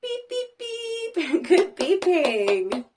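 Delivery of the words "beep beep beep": high, softer tooting beeps; sing-song horn toots; car horn beeps. Shown as a demonstration. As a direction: beep like a car. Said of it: "Can you beep like the car?".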